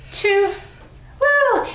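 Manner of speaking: rising woo to hype the class; excited cheer. Real, not acted. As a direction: cheer them through the last rep. Cheering class on final reps.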